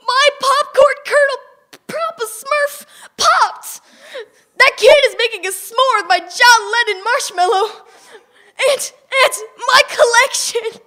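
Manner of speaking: high, loud frantic wail; screaming dad. Acted. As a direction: scream over the lost collection. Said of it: "He was screaming".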